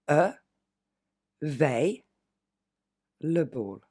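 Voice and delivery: E, veille, le ball, each syllable on its own with pauses; split into syllables. Shown as a demonstration. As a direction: break the word apart slowly. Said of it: "on va découper". (découpé syllabe par syllabe, avec des pauses)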